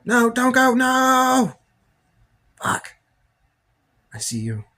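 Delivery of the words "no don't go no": high, loud, pleading cry; hammed-up heartbroken wail; desperate yell. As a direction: shout after them, begging them to stay. Calls after someone leaving.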